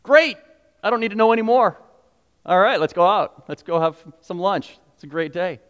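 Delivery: breezy, done-and-dusted tone; casual dismissal; carefree brush-off. Retold or imitated. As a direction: sound like you've heard enough. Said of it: "we're like".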